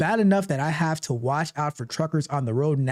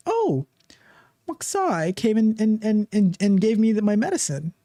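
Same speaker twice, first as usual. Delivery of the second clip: higher put-on patient voice; imitated patient; 5 semitones higher than usual. Host voicing patients pleased with robot's help, higher imitation. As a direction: play a patient in a raised, halting voice.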